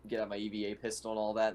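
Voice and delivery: ordinary talk, nothing marked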